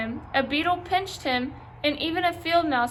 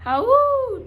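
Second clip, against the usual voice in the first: a high, loud dog howl; loud wolf howl; 11 semitones higher, 7 dB louder than usual. A narrator's reading. Dog howling at the golden moon, loud high howl. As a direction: throw your head back and howl. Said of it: "howled as loudly as he could".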